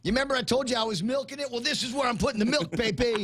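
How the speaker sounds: high, bragging show-off voice; mock swaggering boast. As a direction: strut it like a show-off. Voicing the governor posing.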